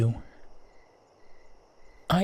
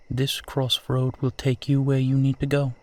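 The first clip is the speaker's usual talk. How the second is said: normal talking voice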